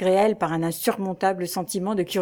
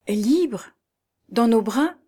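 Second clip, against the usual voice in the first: higher, wondering questions, a touch of sadness; almost sad; 4 semitones higher, 3 dB louder, slower than usual. A narrator's reading. Young woman hearing her father will be free, almost sad. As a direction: repeat it almost sadly, as questions. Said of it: "répéta presque tristement Églantine".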